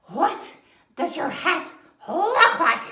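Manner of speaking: a bouncy deer voice breaking into a laugh; high excited deer. Acted. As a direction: deer eagerly quizzing the bear. Deer asks about hat.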